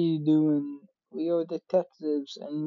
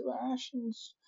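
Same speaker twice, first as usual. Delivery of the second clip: much softer and higher, deflated; small high whimper; 8 semitones higher, 9 dB quieter than usual. Acted. Mourning the lost rations, soft and high. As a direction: let it shrink to a squeak.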